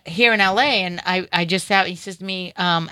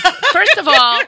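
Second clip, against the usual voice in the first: sassy retort; 10 semitones higher, 7 dB louder, slower than usual. Retold or imitated. Retorting to Chris Spencer.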